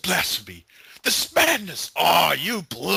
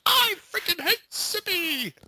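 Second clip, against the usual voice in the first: mock Strongman yell. Quoting Strongman from the video in a high mock yell. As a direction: belt "I freaking hate Simi!" high like a cartoon strongman. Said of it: "it's Strongman with".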